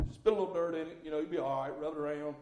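a hint of a gruff dad shrugging off a scraped finger; mock dismissive dad. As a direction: brush it off like a dad who can't be bothered. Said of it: "that's the way men are".

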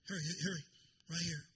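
ordinary talk

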